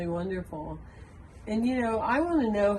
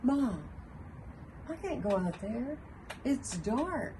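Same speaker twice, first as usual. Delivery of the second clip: put-on higher, pleading child's voice; a small boy afraid to go outside; scared little boy; 5 semitones higher, 5 dB quieter, slower than usual.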